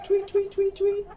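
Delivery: very high, quick chirps; bird tweet. Pretend. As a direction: chirp "tweet" like little birds. Quiz on bird sound.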